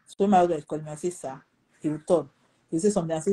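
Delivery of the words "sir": soft respectful sir. Retold or imitated. Answering husband respectfully.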